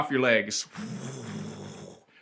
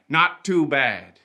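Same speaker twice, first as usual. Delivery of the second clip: slow motto; much slower than usual. Shown as a demonstration. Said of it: "unofficial motto for P E I".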